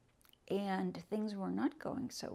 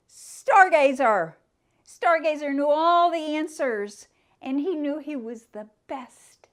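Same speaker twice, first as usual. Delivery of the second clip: big loud proclamation, the name rung out; proud shout; 5 semitones higher, 13 dB louder than usual. A narrator's reading. Storyteller booming the horse's name to the children. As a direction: call it out proud and loud to the room.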